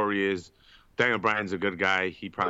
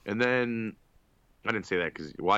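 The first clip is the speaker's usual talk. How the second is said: ordinary talk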